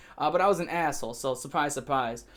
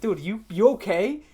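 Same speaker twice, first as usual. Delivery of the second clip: concerned disbelief; 4 semitones higher than usual. Retold or imitated. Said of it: "I'm like".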